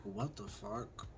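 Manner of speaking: slow, hushed disbelief; stunned hush. Retold or imitated. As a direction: let it drop out in a stunned murmur. Retelling a failed hookup, stunned.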